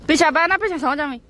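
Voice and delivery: loud shout from the front seat; shouting at sister to sit behind; calling out. Real, not acted. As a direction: call out loud to the back. Calling out in the car.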